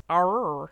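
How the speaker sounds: exaggerated hard R in "Arr"; "Arr" as a strained, overdone American R; forced American R. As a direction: lean on the R sound of "Arr", mocking a bad accent. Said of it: "he's trying to, like, do his R's".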